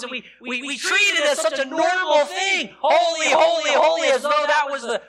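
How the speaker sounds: loud, high, driving preacher voice; fervent preaching. Acted. Preacher stirring the congregation about holy. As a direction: preach it out with rising fire.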